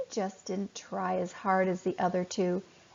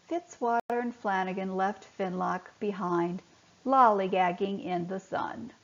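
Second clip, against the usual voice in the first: ordinary delivery, nothing marked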